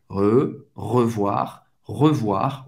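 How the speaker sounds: "revoir" as reu-VWAR, low pitched; low teaching model of "revoir", closed first vowel. Shown as a demonstration. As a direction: say "revoir" with a WA glide and French R. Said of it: "tem o som do wa".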